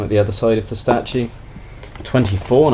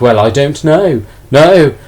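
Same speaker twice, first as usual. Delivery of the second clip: imitating a pressed seller, louder and higher; flustered refusal; 5 semitones higher, 7 dB louder, slower than usual. Seller fending off a forceful buyer, flustered refusal. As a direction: push back in a raised, retold voice.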